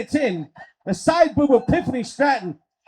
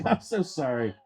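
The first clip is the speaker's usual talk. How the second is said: ordinary delivery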